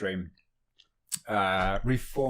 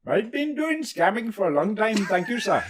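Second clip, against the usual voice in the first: put-on higher-pitched Indian call-center scammer accent; 8 semitones higher than usual. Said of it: "really good with the Indian accent".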